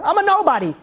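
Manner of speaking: high-pitched self-dismissal; anguished outburst. Retold or imitated. King refusing to go back, anguished outburst. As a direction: burst out with mild despair.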